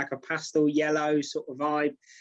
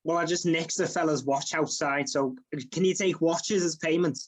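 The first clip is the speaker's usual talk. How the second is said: Scouse customer ordering food; lilting Liverpool Scouse; Scouse accent. Shown as a demonstration. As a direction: put on a chummy Scouse accent. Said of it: "Well, I can do Scouse".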